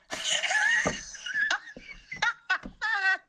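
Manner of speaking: very high laugh breaking into a shout; shrieking laughter. Real, not acted. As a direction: howl with laughter at his reply. Laughs hard at his reply.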